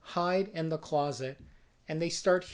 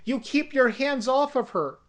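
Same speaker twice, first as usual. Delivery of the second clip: yelling; 5 semitones higher, 4 dB louder than usual. Retold or imitated. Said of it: "and yells".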